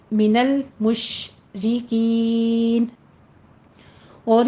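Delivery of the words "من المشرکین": من المشرکین slow and stopped on the final ن; correct stop. Shown as a demonstration. Teacher repeats the right pause on المشرکین. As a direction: hold المشرکین slowly and stop. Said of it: "یہ آپ نے stop کرنے ہیں".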